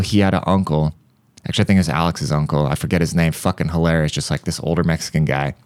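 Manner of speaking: ordinary delivery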